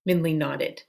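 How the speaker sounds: usual speaking voice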